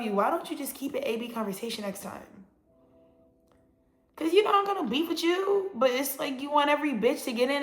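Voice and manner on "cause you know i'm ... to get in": much higher, whiny mock voice; high whiny mimicry of a friend; mock complaining friend. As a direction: whine like a petty friend.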